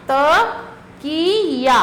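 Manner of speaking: तकिया stretched slowly, syllable by syllable; slow, drawn out. Shown as a demonstration. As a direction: draw out तकिया for the children. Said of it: "और ये चित्र है किसका?". (तकिया को धीरे-धीरे खींचकर बोलिए)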